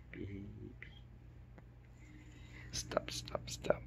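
soft unvoiced whisper. Real, not acted. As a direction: whisper stop gently. Hushed plea to dog.

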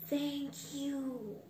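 soft gasp, then hushed thanks; awed thanks. Acted. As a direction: breathe out thanks in amazement. Boy amazed by the coin.

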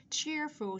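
careful, high demonstration of "Cheerful"; clear "ch" sound. Shown as a demonstration. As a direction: model the ch that opens "Cheerful". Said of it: "chúng ta có âm ch".

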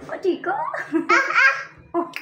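asks again through a high, slow giggle; laughing. Real, not acted. Mother teasing her sulky son, laughing.

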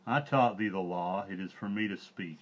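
a lightly voiced wise old bear, grumbling; elder bear scolding the pack; old bear Baloo. A narrator's reading.

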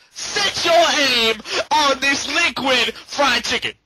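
ordinary talk

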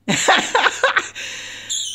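amused rapid giggle; laughing. Real, not acted. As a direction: burst of breathy chuckles. Amused at the bird.

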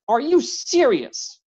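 annoyed doctor snaps at the intruder; snapping. A narrator's reading. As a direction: bite back in disbelief. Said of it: "Benjamin snapped".

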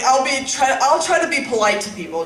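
ordinary talk

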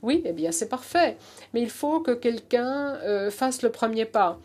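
American accent in French; French spoken with an American accent; foreign accent in french. Real, not acted. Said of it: "mon français est vraiment, euh, rudimentaire".

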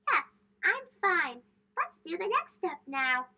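put-on a boy's voice, forcing bravery; brave little boy. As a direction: sound fine though it still stings. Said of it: "Trying to be brave".

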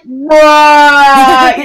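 one word held out loudly, level pitch; long loud bruh. Retold or imitated. Kid replying to the pizza guy, long loud bruh. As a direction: drag it out as a loud mock comeback. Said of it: "he was like".

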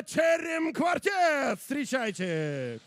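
slow, high, loud announcer shout; ringmaster. Acted. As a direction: draw out the band name to the crowd. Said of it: "меня просили они объявить".

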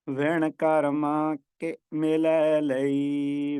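very slow chant running ਕਰਮਾਂ straight on into ਕੇ ਮਿਲੈ; ਵਿਣ ਕਰਮਾਂ ਕੇ ਮਿਲੈ ਲਈ chanted in one breath, slow; no pause at ਕਰਮਾਂ. Shown as a demonstration. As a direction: recite slowly, with ਕਰਮਾਂ ਕੇ joined with no break. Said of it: "ਕਰਮਾਂ ਤੇ ਠਹਿਰਾਅ ਨਹੀਂ ਹੈ".

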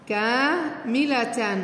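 كاملة drawn out very slowly, syllable by syllable; slowly stretched out. Shown as a demonstration. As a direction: stretch كاملة out slowly so the class can follow. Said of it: "teacher stretches word for class".

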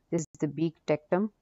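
ordinary talk

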